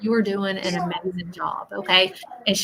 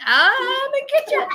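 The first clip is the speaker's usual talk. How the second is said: much higher, teasing put-on voice; playful teasing spirit; 5 dB louder than usual. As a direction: tease like a playful old lady, pitched way up. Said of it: "and she's laughing like".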